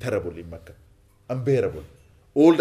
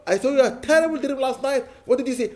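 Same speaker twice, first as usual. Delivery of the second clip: imitating a caller, pitched up high; lightly mocked phone chatter, pitched up high; 11 semitones higher, 5 dB louder than usual. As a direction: act out the nosy phone call, lightly mocking. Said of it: "Some people ring you".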